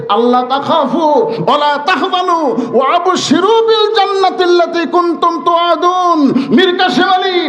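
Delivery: high, ringing sermon climax; full-force preaching. Real, not acted. Preacher proclaiming God's promise of paradise to the condemned man, high impassioned preaching. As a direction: preach it at a high sustained pitch, driving to the promise.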